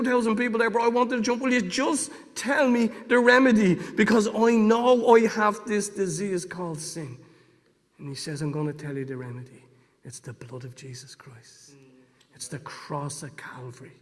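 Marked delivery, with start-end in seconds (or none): 1.4-7.2 high, urgent retold plea; impatient pleading. Desperate listener begging the evangelist to tell him, urgent pleading. 8.4-14.0 acted preacher's hush, letting it land; hushed reveal. Evangelist finally revealing the remedy to the crowd, hushed.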